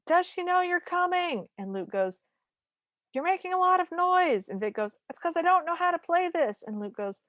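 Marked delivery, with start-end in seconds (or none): 0.1-1.5 high, slow mock yell; high pretend shout, held on one note; mock screamy. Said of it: "having a screamy conversation". 3.1-4.5 high-pitched mock yell; sustained high pretend shout; mock screamy. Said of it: "having a screamy conversation". 5.1-6.6 high-pitched mock yell; high pretend shout, held up until the end; mock screamy. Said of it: "having a screamy conversation".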